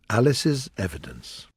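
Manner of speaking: plain reading voice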